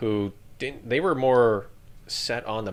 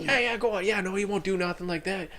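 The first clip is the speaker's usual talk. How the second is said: higher, easygoing Texan reassurance; Texan drawl; 9 semitones higher than usual. Retold or imitated. Dog handler tells the soldier to go ahead, in a high Texan accent. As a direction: wave him on like a relaxed Texan. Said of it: "He's like".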